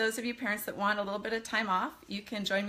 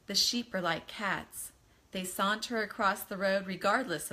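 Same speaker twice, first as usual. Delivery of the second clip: gentle storybook reading voice; story narration; 3 semitones lower than usual. A narrator's reading. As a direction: read the chapter to the kids. Reads novel to children.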